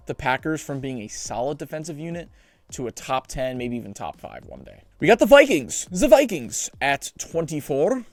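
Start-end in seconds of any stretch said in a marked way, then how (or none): 5.0-8.0 higher, louder put-on accent on "Vikings", slowing down on the second line; a playful, lightly done put-on accent; unnamed put-on accent. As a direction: announce the team in a sudden put-on accent. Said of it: "I don't know why I did that accent".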